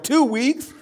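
high, rising mock disbelief; incredulous echo. Pastor hearing bandage stays two weeks, incredulous. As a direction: echo it back, aghast. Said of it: "And I'm like".

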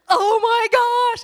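high, loud, slowed "oh my gosh"; a sudden jolt of panic, stretched out; alarmed gasp. Retold or imitated. Mom at Costco realizing she is late, loud alarmed cry.

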